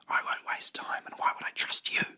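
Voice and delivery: whispered retort; soft, secretive whisper. Acted. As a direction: argue back in a hushed whisper. Secret argument in the boat.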